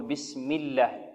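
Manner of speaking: الله with a thin, light lam after the kasra; soft light lam in بسم الله. Shown as a demonstration. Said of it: "ترقق، تقول". (لام مرققة في بسم الله)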